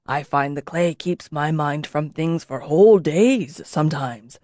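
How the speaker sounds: a hint of a low, unhurried old master; unhurried craftsman, low and slow; lightly voiced old potter. A narrator's reading.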